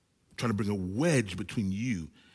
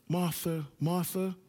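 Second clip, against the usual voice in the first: compassionate call; 5 semitones higher, 5 dB quieter, slower than usual. Retold or imitated. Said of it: "So either it was".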